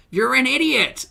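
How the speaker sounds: loud, high, mocking yell of idiot; high exasperated outburst; exasperated shout. Real, not acted. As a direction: yell it at the screen in disbelief. Mocking the woman in the clip.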